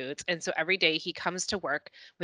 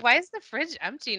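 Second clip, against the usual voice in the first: higher, puzzled whine; mock confusion; 6 semitones higher than usual. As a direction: play the lost young adult. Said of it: "And what, like".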